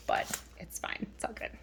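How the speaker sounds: whispering it to herself; soft unvoiced shrug of a line; whispered aside. Real, not acted. Aside about mismatched planner pages.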